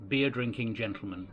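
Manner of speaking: usual speaking voice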